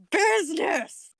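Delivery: high, drawn-out cry of the word; Dead Meat gag. Retold or imitated. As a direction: belt it like a running joke. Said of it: "the running gag from Dead Meat".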